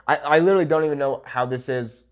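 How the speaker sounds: ordinary talk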